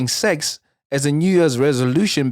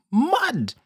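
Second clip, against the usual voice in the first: sudden high squeal of disbelief; mud!; 12 semitones higher than usual. Real, not acted. Reacting to the story, high squeal of disbelief. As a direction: shriek it like you can't believe it. Exclaims at boyfriend's behaviour.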